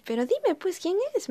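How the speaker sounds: tía curiosa, mucho más aguda; tía chismosa. Retold or imitated. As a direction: imita a la tía ansiosa por saber. Tía curiosa, voz imitada. (tía chismosa que exige el nombre, más aguda y fuerte)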